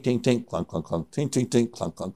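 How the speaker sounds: imitated hammering clinks. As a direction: mimic a piton being hammered. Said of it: "and I hear this tink".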